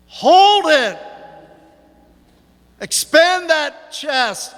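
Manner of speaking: high, drawn-out coach's shout; yelling coach. Retold or imitated. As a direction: holler it like a coach pushing wrestlers through a hold. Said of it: "I could hear your coach yelling".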